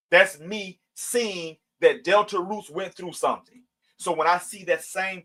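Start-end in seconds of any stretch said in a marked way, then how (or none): none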